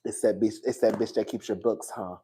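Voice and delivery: a touch of low, quiet menace; low seething accusation. Acted. Wife accusing husband about his bookkeeper, low and quiet. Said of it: "storyline in the movie".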